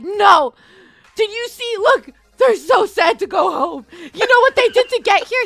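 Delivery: high, loud, insistent plea; overinvested protest. Retold or imitated. Husband defending contestants to his wife, emotionally overwrought. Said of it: "he gets emotionally s-, he gets so invested".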